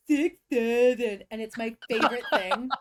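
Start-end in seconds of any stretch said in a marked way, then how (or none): 0.1-1.2 loud, slow, drawn-out six seven; hammed-up loud slow meme call; mock meme voice. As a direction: boom it out slowly like teasing the kids. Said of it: "he goes".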